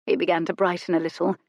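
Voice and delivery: plain reading voice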